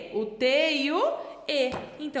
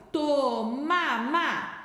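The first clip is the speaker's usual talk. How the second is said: drawn-out syllables, very slow; sounded out slowly; much slower than usual. Shown as a demonstration. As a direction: stretch each syllable for young learners. Teacher sounds out word slowly. (sílaba por sílaba, bem devagar)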